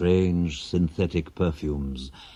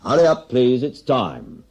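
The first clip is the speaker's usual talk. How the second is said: louder call to the whole room; barman's closing-time shout; pub closing call; 6 semitones higher, 5 dB louder than usual. Acted. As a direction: call last orders over the noise.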